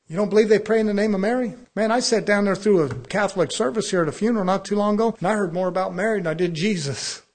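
loud, fast, hammering rebuke; shouting preacher. Acted. Preacher shouting at the congregation about Mary.

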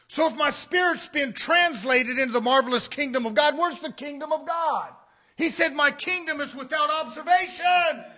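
punchy pulpit delivery; forceful preaching. Real, not acted. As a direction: press the argument on the congregation.

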